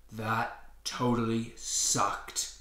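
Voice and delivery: slow, scratchy wheezing voice; scratchy wheeze. Acted. Burnt Octo wheezing to his friends, scratchy and slow. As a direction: croak it out like your lungs are singed. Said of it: "Octo wheezed in a scratchy voice".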